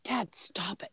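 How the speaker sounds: hushed library plea; mock whisper. Daughter hushing her dad in the library, whispered plea. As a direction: whisper it so nobody hears.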